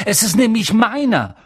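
high, loud indignant claim; furious. A narrator's reading. Harry claims the letter as his own, furious. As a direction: insist angrily that it belongs to you. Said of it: "sagte Harry wütend".

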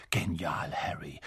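low, hushed admiration; soft, secretive murmur; whispered. A narrator's reading. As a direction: whisper praise to a friend. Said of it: "flüsterte Ron".

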